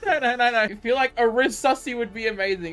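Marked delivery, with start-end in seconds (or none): none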